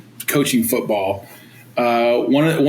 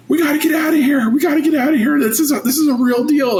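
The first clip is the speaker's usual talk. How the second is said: urgent shout; 12 semitones higher, faster than usual. Retold or imitated. Sergeant orders everyone off the field, urgent shout. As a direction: shout the order to get out now. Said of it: "he's like".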